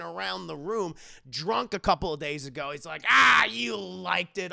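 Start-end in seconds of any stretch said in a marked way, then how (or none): 3.1-4.5 loud, drawn-out retort; loud brush-off. Retold or imitated. Boss answering his offended reporter, loud and dismissive. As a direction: stretch it out loudly, waving off the complaint.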